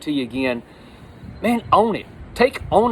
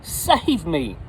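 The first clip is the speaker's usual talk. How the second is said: mildly dramatized cry for rescue, raised on "Lord"; 6 semitones higher, much slower than usual. Acted. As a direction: call out for saving, understated, then drop. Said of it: "he cried, saying".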